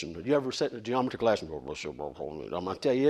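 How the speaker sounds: dull, droning low teacher voice; monotone teacher. Retold or imitated. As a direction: drone on like a boring geometry teacher. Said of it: "monotone voice like this".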